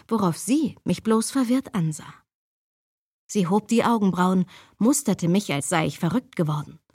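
usual speaking voice

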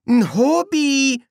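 very high, loud squeak; nervous false name. Acted. Nobby gives false name nervously.

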